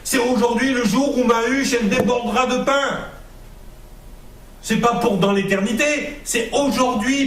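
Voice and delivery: soaring pulpit cry; fiery preaching. Acted. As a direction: preach it out, rising to a peak. Preaches at full force.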